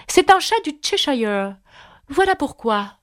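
a lightly voiced haughty duchess. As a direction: toss it off like a curt noblewoman.

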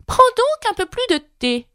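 lièvre de Mars, voix bien plus aiguë, faussement grave; très sérieux. A narrator's reading. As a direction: offrir du thé avec un sérieux comique. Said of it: "le plus sérieusement du monde". (lièvre de Mars solennel, voix aiguë)

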